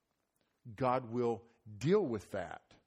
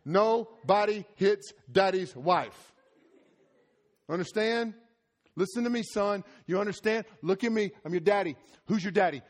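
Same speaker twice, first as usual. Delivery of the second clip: stern dad lecture; 7 semitones higher, 8 dB louder than usual. Retold or imitated.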